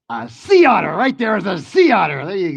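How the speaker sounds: high, excited showman's announcement; triumphant reveal. Real, not acted. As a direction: hit the reveal high and proud. Triumphant reveal of the guessed animal.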